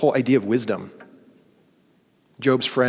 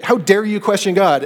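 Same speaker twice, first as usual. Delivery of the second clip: indignant; 7 semitones higher, 5 dB louder than usual. Retold or imitated. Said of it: "It's like".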